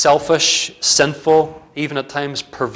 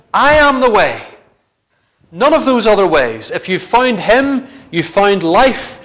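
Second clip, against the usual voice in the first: higher, louder preaching push; impassioned preaching; 5 semitones higher, 3 dB louder than usual. Real, not acted. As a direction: preach it with full conviction. Preaching the good news passionately.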